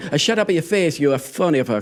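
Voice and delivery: hammed-up Italian accent; mock Italian accent. Italian telling phonies to shut up, mock Italian accent. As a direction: add Italian-style extra syllables between words. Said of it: "I'm Italian".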